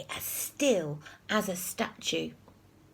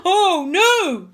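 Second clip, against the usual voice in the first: loud, high gasp of alarm; horrified gasp; 11 semitones higher, 11 dB louder than usual. A narrator's reading. Teacher seeing Peter lying below, horrified cry. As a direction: cry it out aghast. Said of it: "gasped Mister Nurden".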